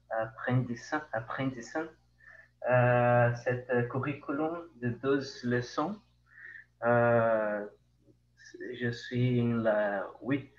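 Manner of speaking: Brazilian Portuguese accent in French; French spoken with a Brazilian accent; foreign accent in french. Real, not acted. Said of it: "mon, mon français".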